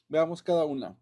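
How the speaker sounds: ordinary talk, nothing marked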